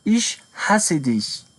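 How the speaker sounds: each word laid out slowly; very slow model sentence. Shown as a demonstration. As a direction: read it out slowly for beginners. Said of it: "model sentence for learners".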